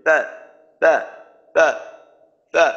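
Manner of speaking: তা with the breath pulled back, no air released; তা clipped off with no outflow of air; air held back. Shown as a demonstration. Teacher demonstrating the wrong airless letter to students. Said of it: "অর্থাৎ এইভাবে করলেন".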